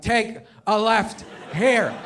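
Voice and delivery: put-on slow, stubborn drunken repeat; hammed-up pushy drunk navigator; drunk friend. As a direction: draw out each word with pauses, insisting. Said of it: "Waze is your drunk friend".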